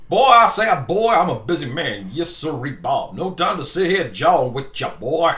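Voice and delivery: cartoon Southern big shot, Foghorn Leghorn style; Foghorn Leghorn Southern accent. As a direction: put on a loud, hammy American South accent. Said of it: "Like Foghorn Leghorn?".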